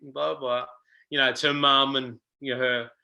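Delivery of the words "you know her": ordinary talk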